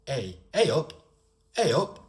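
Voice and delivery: high regional British "hey up"; punchy dialect greeting in a regional accent. Shown as a demonstration. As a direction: call out a greeting in a local accent. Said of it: "to certain parts of the United Kingdom".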